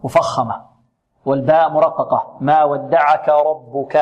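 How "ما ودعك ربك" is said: slow, with the ra of ربك full and heavy. Shown as a demonstration. Teacher modelling the heavy ra of ربك. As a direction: say ربك with a thick ra. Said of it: "الراء هنا مفخمة".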